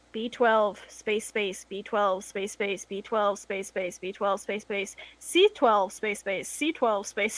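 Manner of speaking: slow, monotonous typing chant; droning repetition. Retold or imitated. Store worker showing how they type out stickers, droning repetition. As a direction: drone it out like endless data entry.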